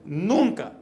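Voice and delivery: "nunca" lifted high, dropping at the end; emphatic. Retold or imitated. Son repeating mamá's rule to her, emphatic "nunca". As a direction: hammer "nunca" as an absolute rule.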